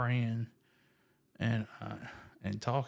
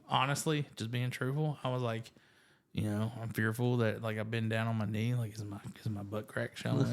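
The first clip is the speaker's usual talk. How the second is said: usual speaking voice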